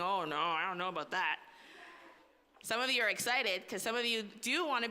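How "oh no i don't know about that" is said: low, reluctant "oh no" groan; doubtful kid voice. Retold or imitated. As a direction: mimic a hesitant kid. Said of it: "there's some people that are saying".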